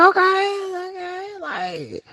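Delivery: high, whiny mock outrage with a crying edge; exaggerated high disbelief ending in a yelp; mock exasperated. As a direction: whine it like you can't believe someone is this uptight. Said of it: "I'm like".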